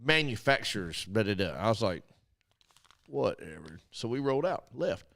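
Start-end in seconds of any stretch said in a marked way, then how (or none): 3.1-3.8 flat, soft brush-off, much quieter; dismissive whatever. Retold or imitated. Dismissing the salesman, soft and uninterested. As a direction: shrug it off, barely bothering.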